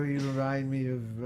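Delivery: gushing director impression; imitated theatrical storyteller; Peter Bogdanovich. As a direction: begin a director's anecdote in his affected voice. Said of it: "somebody like Peter Bogdanovich".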